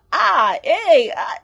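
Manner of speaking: hammed-up gasps of admiration; playing a man awed by his girlfriend; demonstrating exclamations. Shown as a demonstration. Showing wild exclamations.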